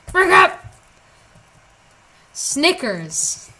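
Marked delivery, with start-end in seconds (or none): 0.1-0.5 loud, high yell; sudden shout, pitched up; yelled outburst. Pretend. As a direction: burst out shouting after the chant. Yelled end of repeated chant.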